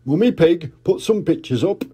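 jolly put-on dad voice; Daddy Pig. Daddy Pig remembering, hearty dad voice. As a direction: chuckle it out as a big daddy pig. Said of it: "said Daddy Pig".

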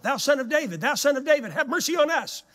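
loud, high pleading cry; desperate beggars crying after Jesus; blind men shouting. Retold or imitated. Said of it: "began to shout".

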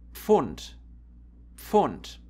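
"Pfund" with a barely heard p before f; careful "Pfund", p melting into f; pf pronunciation demo. Shown as a demonstration. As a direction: show "Pfund" starting on one pf sound. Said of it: "You hardly can hear the P here".

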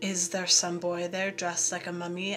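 ordinary delivery, nothing marked

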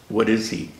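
ordinary delivery

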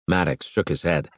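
usual speaking voice

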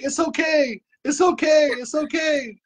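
put-on high pleading child voice; son pleading. A mother imitating her nonverbal son's pleading, high. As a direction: imitate a scared boy soothing himself, pitched high. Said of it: "he'll be like".

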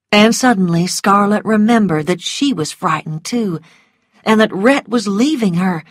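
ordinary delivery

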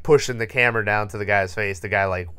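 usual speaking voice

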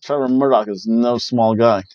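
ordinary talk

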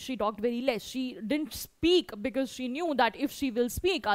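ordinary talk

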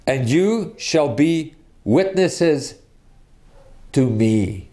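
slow reading, long pause before "to me"; stress on "to me". Shown as a demonstration. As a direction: hold back, then land weightily on "to me". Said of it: "Here is the emphasis".

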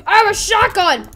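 high, loud gleeful yell; battle shout. Acted. Kid voicing an armed figure, loud shout. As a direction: shout it as a charging fighter.

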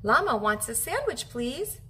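usual speaking voice

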